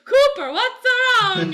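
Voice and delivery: very high, loud, drawn-out cartoon plumber voice; Mario impression. Costumed dad asking his little kid, in high cartoon voice. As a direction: call out slowly in a high mascot voice. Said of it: "my oldest brother was Mario".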